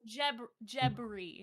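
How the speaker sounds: "Jebri" said slowly, with a b; careful clarification. Shown as a demonstration. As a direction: spell out "Jebri" by ear, slowly. Clarifying a misheard invented name.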